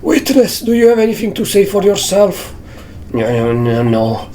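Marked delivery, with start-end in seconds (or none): 0.0-2.5 high, stern judge voice; squeaky courtroom judge. Acted. Voicing the judge.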